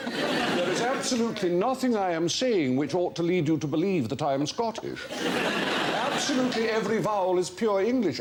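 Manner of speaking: put-on high-pitched, insistent Scottish accent; indignant, unmistakably Scottish accent. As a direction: imitate a Scot convinced he sounds English. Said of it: "one Scot who spoke a form of".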